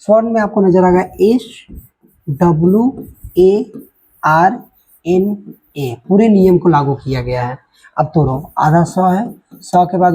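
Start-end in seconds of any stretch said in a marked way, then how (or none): 1.2-5.9 slow spelling. Shown as a demonstration. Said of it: "spelling out letters slowly".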